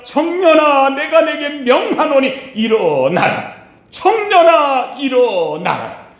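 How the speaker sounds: Jesus booming his command over the coffin; commanding call. Acted. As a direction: call the dead youth to rise with full authority. Jesus commands the dead son.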